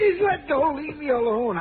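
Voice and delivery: high plea falling as he gives in; panicked surrender. Acted. Intruder giving in to detective's grip, frightened plea. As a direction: beg to be let go, starting very high.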